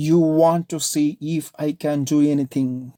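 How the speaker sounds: loud, slow, every word spelled out; each word clear. Acted. Nomita warning Ajit, each word deliberate and loud. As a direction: deliver it word by word, menacingly. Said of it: "pronounces each word clearly".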